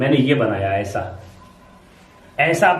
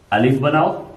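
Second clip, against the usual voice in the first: usual speaking voice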